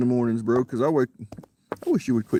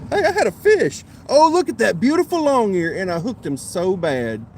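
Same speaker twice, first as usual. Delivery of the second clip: high, loud burst of excitement; excited; 8 semitones higher, 7 dB louder than usual. Real, not acted. Angler shows viewers his longear catch, excited. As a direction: exclaim over a surprise catch. Angler has just landed a fish.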